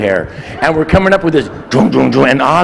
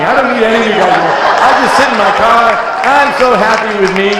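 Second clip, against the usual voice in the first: higher, fast self-satisfied prattle; smug kid voice; 6 semitones higher than usual. Pretend. Retold childhood self, higher fast smug brag. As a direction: brag like a content loner. Mock younger self, comic.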